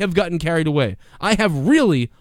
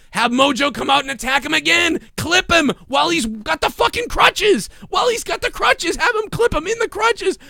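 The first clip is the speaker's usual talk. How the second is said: much higher, rising into a yell; excited; 10 semitones higher than usual. Real, not acted. Host pitching a storyline to viewers, excited yell. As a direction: get carried away pitching the idea.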